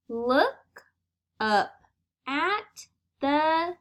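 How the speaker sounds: put-on slow, word-by-word read-aloud for young readers; slow reading to children. Shown as a demonstration. Teacher reading the duck story to her class, slow and clear. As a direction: model each sentence slowly so the class can follow.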